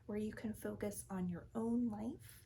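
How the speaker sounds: ordinary talk, nothing marked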